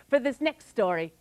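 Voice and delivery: usual speaking voice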